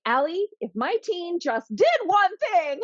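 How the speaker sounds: very high, whiny mock-parent voice; exasperated parent. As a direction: squeal the objection like a fed-up parent. Mimics exasperated parent's objection.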